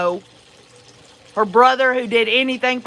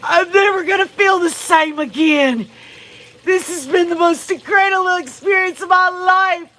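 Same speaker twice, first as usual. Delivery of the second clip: mock ecstatic lover; 6 semitones higher than usual. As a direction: shout it like a swooning parody. Mocking blissed-out lover.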